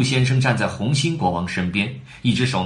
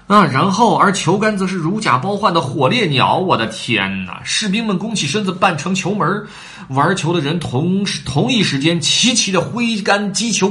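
plain reading voice

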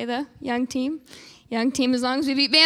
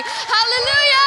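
higher, drawn-out Hallelujah; praise call; 9 semitones higher, 4 dB louder than usual. Real, not acted. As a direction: call it out to lift the church. Preacher calling praise.

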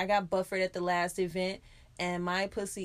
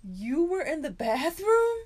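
slow, higher stunned question; jaw-drop surprise; 6 semitones higher, 4 dB louder, slower than usual. Retold or imitated. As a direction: imitate her shock at seeing me. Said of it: "her jaw dropped".

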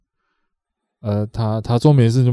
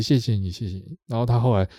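usual speaking voice

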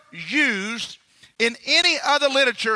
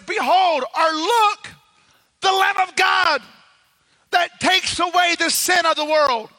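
proclaiming; 5 semitones higher than usual. Acted. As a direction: announce the Lamb of God to the crowd. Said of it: "And John says".

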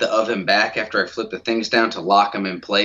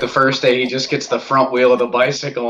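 ordinary delivery

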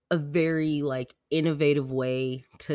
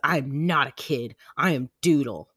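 louder, emphatic mock indignation; imitating pissed kid; 7 dB louder than usual. Daughter rejecting being called a kid, indignant. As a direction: insist on each word like an offended child. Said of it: "She got pissed".

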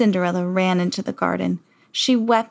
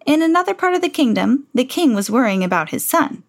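usual speaking voice

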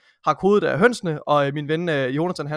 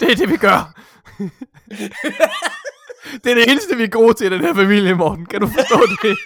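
high, loud put-on dad voice broken by laughs; joking father telling Morten this is what the family does; mock stern father; 5 semitones higher, 5 dB louder than usual. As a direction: lecture the boy like a hammy farm father.

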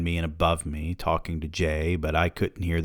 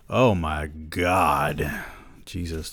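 slow groaning Oh my God; exasperated; 2 semitones lower, much slower than usual. Real, not acted. Reader reacting to how many paragraphs remain, exasperated. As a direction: sigh it out, overwhelmed. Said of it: "That's my reaction to how".

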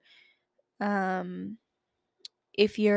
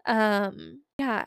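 ordinary talk, nothing marked

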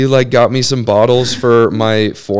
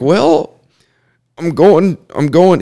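imitating great uncle; 7 semitones higher, 4 dB louder than usual. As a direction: lift the pitch high and stretch the well. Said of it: "He talks like that".

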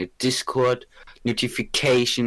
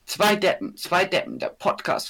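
excited announcing; 9 semitones higher than usual. Real, not acted. Proudly reading new description.

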